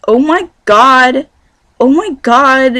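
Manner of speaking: loud, high, drawn-out mock Valley girl. Showing viewers how Californians don't talk, exaggerated accent. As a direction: ham up a gushing Californian cliché, slowly. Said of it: "we don't talk like this".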